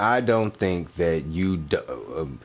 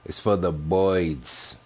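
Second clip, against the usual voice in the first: mock Boston accent; slower than usual. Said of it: "How y'all say birds up in the Northeast?".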